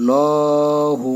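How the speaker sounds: লহু drawn out long and heavy; long drawn lahu. Shown as a demonstration. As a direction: stretch the vowel in লহু very slowly, one alif long. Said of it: "এক আলিফ টান দিবেন".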